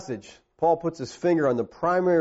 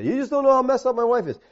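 higher, quick protest; complaining husband; 10 semitones higher, 4 dB louder than usual. Acted. Husband objects to the preacher about his wife, complaining. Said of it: "You say".

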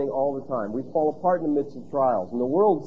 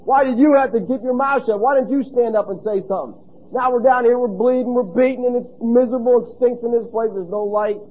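high, loud exasperated retort; Paul snapping back; 7 semitones higher, 5 dB louder than usual. Pretend. Paul retorting to Silas in the dungeon, loud and exasperated. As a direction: throw the blame right back. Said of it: "Paul could say".

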